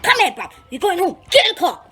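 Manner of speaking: hammed-up command to get in the car; imitated bossy brother. Brother drags her home.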